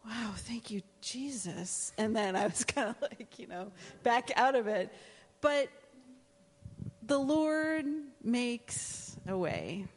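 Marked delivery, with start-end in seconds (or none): 0.1-1.9 awed quiet thanks. Retold or imitated. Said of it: "I remember looking at that and going". 5.4-9.9 preaching emphasis. Acted. Preaching declaration.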